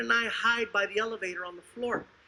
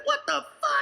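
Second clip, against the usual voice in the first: squeaks up high in shock; shocked outrage. Acted. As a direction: react to the insult. Voice changed reading card.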